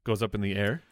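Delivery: ordinary talk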